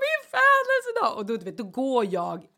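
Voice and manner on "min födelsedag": high, loud, slow sob; crying to husband about her birthday. Retold or imitated. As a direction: wail the word through tears. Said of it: "gråter bara så ovärdigt".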